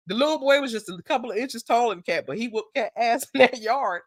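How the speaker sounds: ordinary talk, nothing marked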